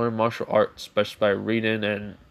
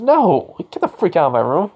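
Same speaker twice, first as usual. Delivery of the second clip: yelling no; 6 semitones higher, 7 dB louder than usual. Acted. Issei throws Renair out.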